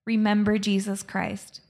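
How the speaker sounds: usual speaking voice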